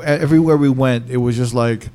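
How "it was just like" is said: ordinary delivery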